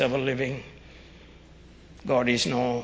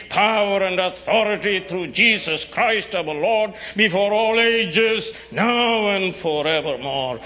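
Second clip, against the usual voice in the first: higher, louder ringing doxology; preaching at full force; 6 semitones higher, 6 dB louder than usual. Acted. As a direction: proclaim each word with rising force.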